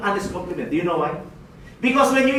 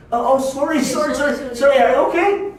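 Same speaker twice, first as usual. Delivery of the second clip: high, louder, repeated mock apology; flustered apology; 5 semitones higher, 4 dB louder than usual. Shopper apologises for hitting his leg, mock flustered. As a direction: apologise in a hurry, pitched up and anxious.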